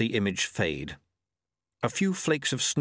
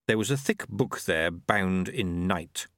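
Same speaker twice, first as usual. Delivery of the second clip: usual speaking voice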